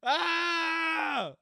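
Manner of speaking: loud high "Ah!" scream; sustained full-volume yell; game scream. Pretend. Player screaming to survive in a game, screamed. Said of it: "screaming to survive".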